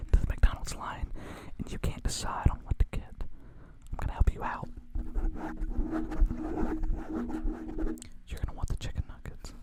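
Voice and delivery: soft unvoiced ASMR hush; ASMR whisper. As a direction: whisper a cozy sales pitch. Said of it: "Just a little A S M R".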